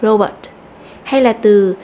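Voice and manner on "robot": robot with the first syllable RO stressed; RO-bot, first syllable punched. Shown as a demonstration. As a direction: lean on RO in robot. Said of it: "nhấn mạnh vào âm đầu".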